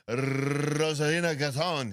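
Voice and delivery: long trilled Spanish R, name drawn out slowly; milk the Spanish rolled R; exaggerated Spanish accent. Host mocks singling out one name, exaggerated Spanish accent. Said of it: "put a little salsa on that".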